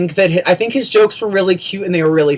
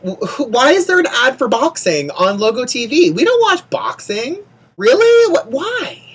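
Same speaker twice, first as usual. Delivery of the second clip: incredulous; 8 semitones higher than usual. Real, not acted. Incredulous at boxing ad.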